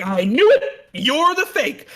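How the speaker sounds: loud, then high mock outburst; mock accusation. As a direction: accuse them in hammed-up indignation. Mocking a character's sudden accusation.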